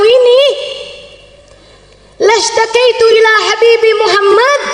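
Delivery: high, declaimed protest of a mocked villager; ringing, offended outburst; indignant rebuke. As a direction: confront the copycat, fed up and wounded. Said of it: "Saya udah nggak tahan lagi".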